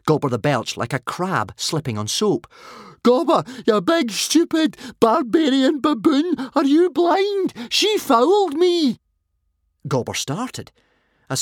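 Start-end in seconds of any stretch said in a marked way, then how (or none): 3.0-9.0 very high, loud outraged screech; bellowing rage. A narrator's reading. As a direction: scream the insults at the referee. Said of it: "bellowed Fishlegs".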